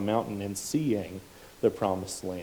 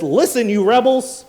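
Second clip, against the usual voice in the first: loud, higher angry outburst; angry shout; 12 semitones higher, 11 dB louder than usual. Retold or imitated. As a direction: bark it at the crowd. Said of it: "Moses got up there and says".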